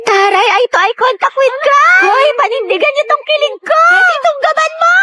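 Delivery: high, slow squealing cheer; giddy shouted teasing; excited cheering. Acted. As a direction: hype the crush moment, rising up. Classmates cheering on crush moment.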